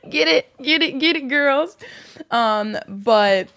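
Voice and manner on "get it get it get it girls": high cheering squeal. Retold or imitated. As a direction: hype them up, high and excited. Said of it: "I was like".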